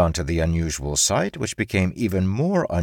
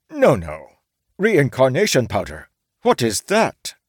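louder, rising to a high fake question; feigned ignorance; 7 semitones higher, 4 dB louder than usual. A narrator's reading. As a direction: samurai failing to play dumb.

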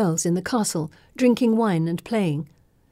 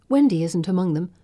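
ordinary delivery

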